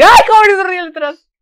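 high, loud excited squeal at the screen; a sudden high-pitched outburst. Real, not acted. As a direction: shriek it out in delight. Character laughing in game.

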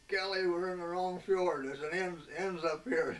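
shouted high and loud, half-laughing alarm; retold holler over the phone. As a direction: yell the warning into the radio. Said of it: "we hollered on the phone".

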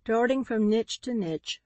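usual speaking voice

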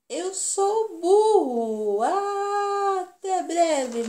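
high, slow, loud drawn-out donkey; silly donkey voice. Acted. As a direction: bray it out like a cartoon donkey. Donkey signs off to kids. (voz de burro arrastada)

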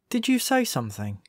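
high, nervous question; a hint of nerves at a talking badger. A narrator's reading. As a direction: ask it warily, a touch on edge.

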